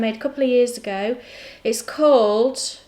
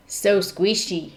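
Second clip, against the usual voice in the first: Matt impression. Knitter imitating Matt on how squishy yarn is, botched impression. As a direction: lightly imitate someone, stretching the words. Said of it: "I was trying to do a matte impression".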